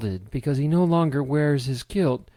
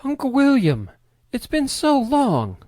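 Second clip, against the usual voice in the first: much higher, louder joyful greeting; delighted cry; 12 semitones higher, 5 dB louder than usual. A narrator's reading. Niece running to hug her uncle, overjoyed high cry.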